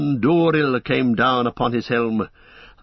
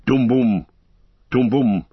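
wild drum beat imitation; 4 dB louder than usual. A narrator's reading.

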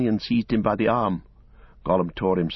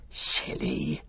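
a breathless, lower hiss; 8 semitones lower, 6 dB quieter than usual. A narrator's reading. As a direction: spit it out as a soft hiss. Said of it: "hissed Gollum".

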